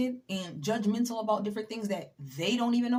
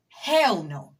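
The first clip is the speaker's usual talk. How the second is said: Hell no punched out louder, Hell hit hard; louder firm refusal; loud emphatic refusal; 8 dB louder than usual. Real, not acted. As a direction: slam the door on it, loud.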